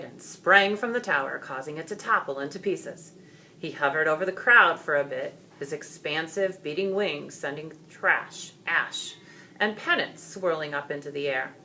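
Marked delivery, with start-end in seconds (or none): none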